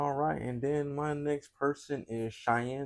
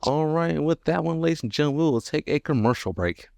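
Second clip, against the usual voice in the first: loud, higher-pitched announcer call; mock show host; 5 semitones higher, 8 dB louder than usual. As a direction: throw to commercial like an emcee. Announces a mock commercial break.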